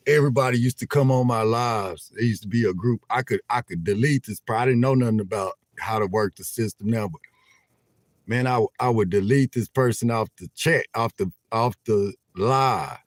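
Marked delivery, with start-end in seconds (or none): none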